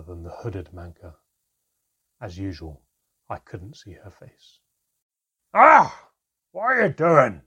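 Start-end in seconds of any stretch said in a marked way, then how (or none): 5.5-7.4 high, loud furious snarl; a shouted snarl of outrage; venomous snarl. A narrator's reading. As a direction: spit it out at her with venom. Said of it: "snarled Handan with a rare venom".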